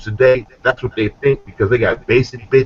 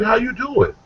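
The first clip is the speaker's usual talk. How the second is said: mock-innocent, far above usual pitch; high-pitched protest; 12 semitones higher than usual. Offender excusing himself to the one he stabbed, high protest.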